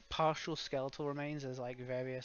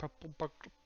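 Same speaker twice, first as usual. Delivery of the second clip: Scottish accent. Shown as a demonstration. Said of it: "Because I'm trying to do the accent".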